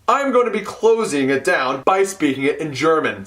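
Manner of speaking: ordinary talk, nothing marked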